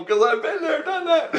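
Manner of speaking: very high, giggling boast; laughing brag. Retold or imitated. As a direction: toss it off, laughing at your own cleverness. Smug reply, laughing.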